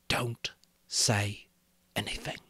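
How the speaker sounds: urgent whisper. A narrator's reading. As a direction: whisper straight into his ear. Mouth against Piggy's ear.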